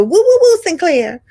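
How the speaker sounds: very high, loud sing-song woo; a fan greeting Sinclair with her catchphrase; fans' greeting. Retold or imitated. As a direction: hail her like an excited fan. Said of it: "people who will woo, woo, woo me".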